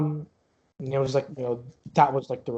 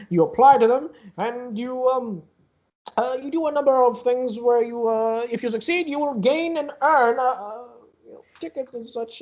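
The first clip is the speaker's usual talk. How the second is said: higher-pitched carnival barker pitching his wares; showy carnival ticket-booth boss; 9 semitones higher, 3 dB louder than usual. Acted. Booth boss tells visitors about his plushies and magical items, showy. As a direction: hawk the prizes with theatrical flourish, softening at the end.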